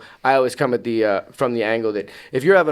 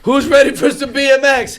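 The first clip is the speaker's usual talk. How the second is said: high, loud BMX show hype; hyped crowd shout; 13 semitones higher, 7 dB louder than usual. Acted. BMX performer calling out to the school crowd, hyped shout. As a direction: pump up the crowd, pitched way up.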